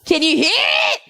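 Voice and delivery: high goofy repeat; high put-on voice. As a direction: sing it up high, teasing. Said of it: "repeated in higher voice".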